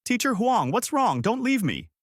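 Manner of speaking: very high, panicked scream. A narrator's reading. Young teacher running to her mentor, high frantic scream. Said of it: "ran up screaming".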